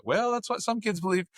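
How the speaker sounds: high, sing-song dodge of the question; evasive parent. Retold or imitated. Parents dodging kids' Santa question.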